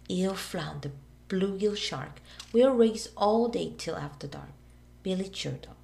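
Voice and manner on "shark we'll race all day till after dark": ordinary delivery, nothing marked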